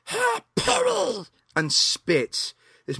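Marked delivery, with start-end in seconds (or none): 0.1-1.3 very high, drawn-out creature hawk; Stripe. Retold or imitated. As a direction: imitate a nasty little monster about to spit. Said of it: "just turns around and goes".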